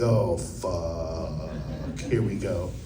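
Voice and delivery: low, hushed oh-no to oneself; quiet, low muttered dread; dismayed aside. Retold or imitated. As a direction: drop the voice way down, sinking realization. Inner reaction to police challenge.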